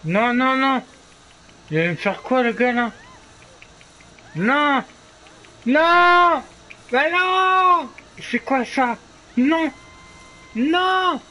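rising panic, begging no; panicked pleading. Real, not acted. Panicking as the killer approaches.

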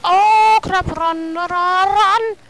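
loud, high, stretched cries to run; alarmed shouting. Real, not acted. Gamer fleeing danger, alarmed shouting. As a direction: yell it in comic panic.

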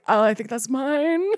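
slow, timid little "oh, that's mine"; meek mock reply. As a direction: sheepishly claim the cup, slowly. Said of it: "And I was like".

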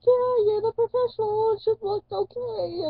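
high, loud, nervous reply, slowing at the end; high put-on nervous voice. To the piercer, deferring nervously in a high loud voice. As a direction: play it as a nervous girl deferring to the expert. Answering piercer about placement.

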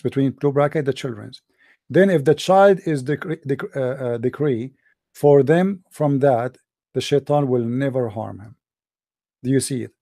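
usual speaking voice

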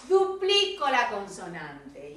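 normal talking voice

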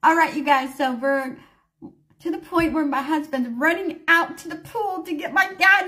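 ordinary delivery

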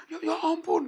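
high, loud, stammered dismay; theatrical cry of shock; shocked exclamation. Acted. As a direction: react to terrible news, hammed up. Tour leader learns of the death.

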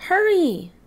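high, loud eager call; eager hurry. Acted. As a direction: urge a friend on excitedly. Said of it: "said Digger".

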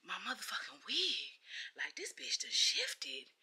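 hushed annoyed whisper. Real, not acted. Aside to viewers about her shifted wig, annoyed whisper. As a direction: whisper it under your breath, irritated.

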